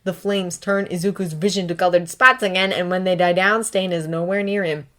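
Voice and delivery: ordinary delivery, nothing marked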